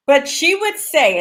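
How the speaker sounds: ordinary talk, nothing marked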